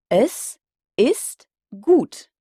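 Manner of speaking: "Es ist gut" drawn out very slowly, the final T of gut clearly heard; slow clear German. Shown as a demonstration. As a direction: spell out each sound of "Es ist gut", landing on the T. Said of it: "On doit donc bien entendre le T final".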